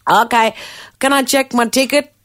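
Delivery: high, loud acted request; mock officious. Retold airport confrontation, lady's reply.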